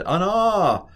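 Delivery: high, drawn-out cry; exaggerated wailing exclamation; acted interjection. Teacher showing what the sailors cried, hammed-up interjection.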